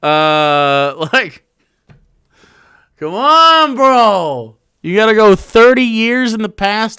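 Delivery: loud incredulous laugh-yell; loud exasperated outburst. Real, not acted. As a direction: throw up your hands, laughing disbelief.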